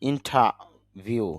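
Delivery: normal talking voice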